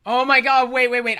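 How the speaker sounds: loud, high interruption; alarmed realization. Real, not acted. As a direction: burst in as the memory hits. Suddenly recalls something dreadful.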